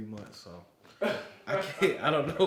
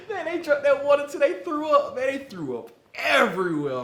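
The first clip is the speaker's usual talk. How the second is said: higher, louder, laughing through it; 14 semitones higher, 10 dB louder than usual. Real, not acted. Teller to listener, laughing at the ending. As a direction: crack up telling the ending.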